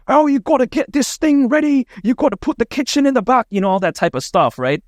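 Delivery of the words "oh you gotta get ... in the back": high-pitched mock British accent; British chef impression, much higher than usual voice; Gordon Ramsay. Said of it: "That's my British accent".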